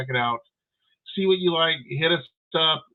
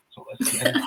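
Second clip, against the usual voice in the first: laughing; 10 semitones higher than usual. Real, not acted. Host brings guest on, laughing.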